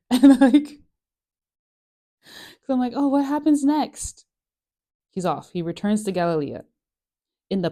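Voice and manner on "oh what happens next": eager curiosity. Retold or imitated. Reader eager to keep reading.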